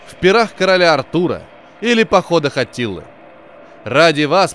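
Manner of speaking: grand showman captain addressing passengers; торжественный капитан. Acted. Капитан торжественно вещает пассажирам. (торжественная речь капитана)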